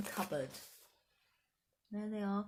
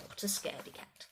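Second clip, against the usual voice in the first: plain reading voice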